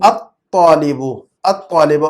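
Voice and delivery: الطالب drawn out, laam silent, T doubled; assimilated article. Shown as a demonstration. Teacher models for pupil, الطالب slowly with doubled T and no L. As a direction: hold the stop from A into a heavy T in الطالب. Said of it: "تا میں لے جا کر touch کر دیں گے، لام کی آواز کو ختم".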